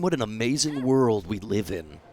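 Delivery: ordinary delivery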